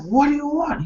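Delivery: high-pitched pestered retort; mock-irritated outburst at a pest; hammed-up annoyed demand; acted exasperation. Pestered man snaps back, story retold.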